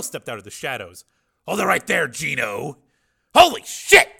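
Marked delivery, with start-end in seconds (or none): 1.5-2.8 commanding. A narrator's reading. Hero stops a thug. 3.3-4.1 startled shout. A narrator's reading. Thug startled.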